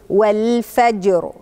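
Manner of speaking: والفجر with a thick, emphatic final r; slow والفجر ending on a heavy rolled r; heavy final raa. Shown as a demonstration. Teacher showing the heavy r in والفجر. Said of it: "يبقى هقول إيه؟".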